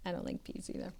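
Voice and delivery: soft whispered confession; whispered aside. Real, not acted. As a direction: confess it under your breath.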